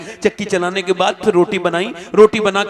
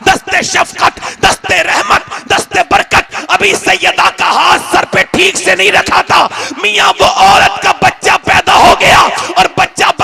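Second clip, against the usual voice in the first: shouted sermon climax, much higher and louder; preaching at full force; 10 semitones higher, 10 dB louder, slower than usual. Acted. Preacher to the gathering, shouting the miracle story at full force. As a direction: build to a roaring climax.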